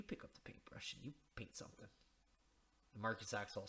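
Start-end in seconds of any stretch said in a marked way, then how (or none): 0.0-1.9 whisper. Retold or imitated.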